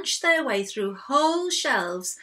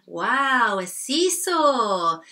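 loud, drawn-out wonder; excited storybook exclamation; delighted discovery; 6 dB louder, much slower than usual. A narrator's reading. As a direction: exclaim slowly with glee. Goat delighted at finding a seesaw.

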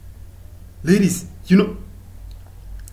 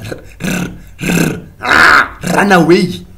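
mock scared; 4 dB louder, slower than usual. Acting scared warning.

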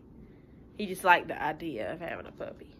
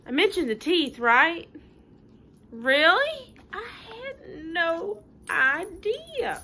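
mock surprise; 10 semitones higher, 4 dB louder, much slower than usual. Pigeon pretends it warned them.

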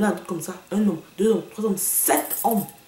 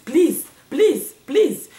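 high, loud, repeated pleas; emphatic high-swooping "please"; exclaimed "please"; 6 semitones higher, 5 dB louder, much slower than usual. Real, not acted. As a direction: pitch each "please" up sharply. Exclaimed repeated plea, switching to English.

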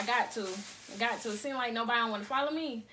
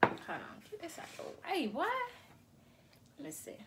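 whispering; 4 semitones higher, 9 dB quieter than usual. Real, not acted. Setting up thumbnail.

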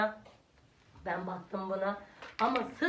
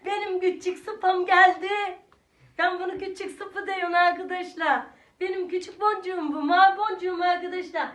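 excited greeting; 8 semitones higher, 8 dB louder than usual. Real, not acted. Cooing over grandson on camera.

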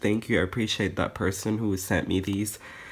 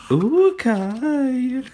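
swooning ooh; 12 semitones higher, 4 dB louder than usual. Real, not acted. Gushing over Kai's photo.

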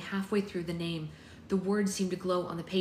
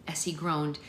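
ordinary delivery, nothing marked

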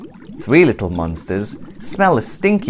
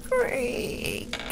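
soft high breathy creak; creaking door; 11 dB quieter than usual. A narrator's reading. Secret door slowly opening, soft creak. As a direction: let the word creak like an old hinge. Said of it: "goes a secret door".

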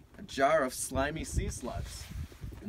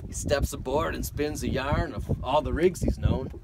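sea ballad read with a lilt; poem recitation; 6 dB louder than usual. Acted. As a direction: recite it like an old sailor's ballad.

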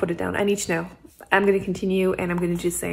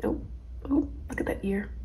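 normal talking voice

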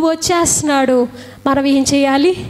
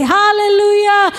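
drawn-out hallelujah; 3 semitones higher, slower than usual. Real, not acted. Preacher exclaims in sermon.

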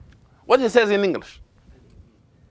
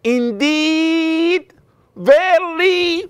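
"Indeed, verily" stretched very long and high; exaggerated elongation; 14 semitones higher, 5 dB louder, much slower than usual. Shown as a demonstration. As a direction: drag out the vowels of "Indeed" and "verily", pitched way up and loud. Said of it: "can you say".

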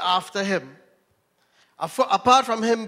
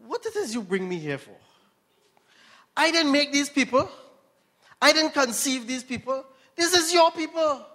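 pleading, exasperated Moses, pitched up; Moses complaining to God; 6 semitones higher than usual. Retold or imitated. Moses begging God to take his life, exasperated. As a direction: throw up your hands like a worn-out leader. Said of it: "Moses went to God and said".

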